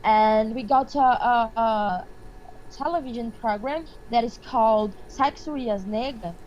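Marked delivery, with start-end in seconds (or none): none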